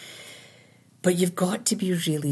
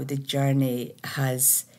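ordinary talk

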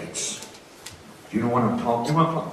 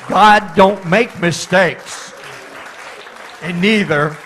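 rising to a full-voiced preaching shout, much higher and louder; building preaching shout; 6 semitones higher, 7 dB louder than usual. Real, not acted. Preacher to his congregation, after the baby story, shouting the point. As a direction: build it up till the whole church hears the climax.

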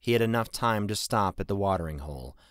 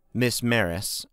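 ordinary delivery, nothing marked

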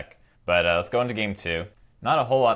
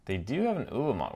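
normal talking voice

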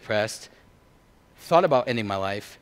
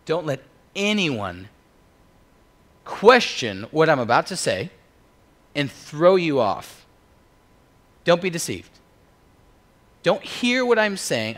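forceful preaching, raised and punched out; overemphasized; 5 semitones higher, 7 dB louder than usual. Acted. Preacher warning the church in Paul's words, overemphasized. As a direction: drive each key word home, louder and higher. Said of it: "I think I'm overemphasizing Paul here".

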